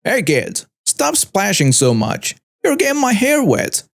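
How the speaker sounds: put-on understated yell at a child; annoyed yell. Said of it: "I heard her yell at Caleb".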